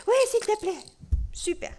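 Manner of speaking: high eager little puppet voice. Acted. Puppet Tolo accepts the question, high eager. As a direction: accept as the excited teddy bear. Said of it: "Ça, c'est Tolo".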